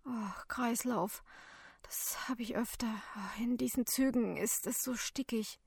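soft, breathy, woozy voice; faint, weak. A narrator's reading. Collapsed passenger explains himself to helper, weakly. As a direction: speak feebly, just coming round.

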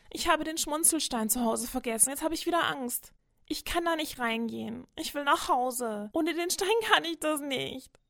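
put-on high, tearful girl's plea, slowing down; a scared child close to tears; mildly upset little girl on the edge of crying. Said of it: "Ella fängt an zu weinen".

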